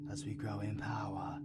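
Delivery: hushed ominous whisper. Acted. V confiding to El in a whisper. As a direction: drop to a dark whisper. Ominous talk of dark magic.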